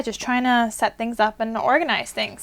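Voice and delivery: ordinary delivery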